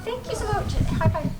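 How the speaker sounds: very high, drawn-out squeal of delight; excited squeal. Real, not acted. Offered cat stickers by a fan, excited squeal. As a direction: squeal it slowly, over the moon.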